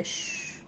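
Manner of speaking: put-on a sharp whispered shh to silence a child; shushing. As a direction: hush her like a scolding adult. Voicing adults hushing a loud girl. (chistido para callar)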